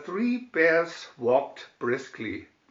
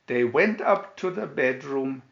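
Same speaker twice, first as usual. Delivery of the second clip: usual speaking voice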